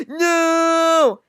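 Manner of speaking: loud high cry of no; loud high anguished scream of no. Retold or imitated. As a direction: cry out as a friend falls. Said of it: "everybody's like".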